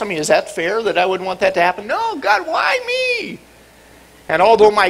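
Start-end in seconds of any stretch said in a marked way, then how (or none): 1.9-3.4 Nancy Kerrigan wail. Retold or imitated. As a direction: wail like a hurt victim. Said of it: "I did a Nancy Kerrigan".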